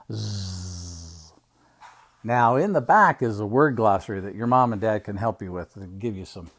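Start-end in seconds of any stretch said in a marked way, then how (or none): 0.1-1.3 soft, low "zzz" snooze; drawn-out buzzing Z. Acted. Uncle ending the Z page, soft buzzing snore. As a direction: let a long Z buzz fade away. Zebra book ends on Z sound.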